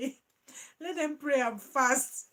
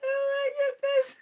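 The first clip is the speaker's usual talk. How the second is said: high, loud, laughing; laughing exclamation; 12 semitones higher, 5 dB louder than usual. Real, not acted. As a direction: gasp it out mid-laugh. Laughing fit.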